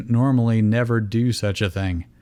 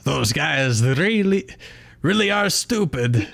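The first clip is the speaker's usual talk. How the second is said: luchador bird voice; 5 semitones higher than usual. Shown as a demonstration. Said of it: "Does that sound like a luchador bird".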